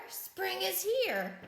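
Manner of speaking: high, slow, drawn-out good news; lively storybook bear exclamation; excited announcement. A narrator's reading. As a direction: announce it brightly to a sleeper.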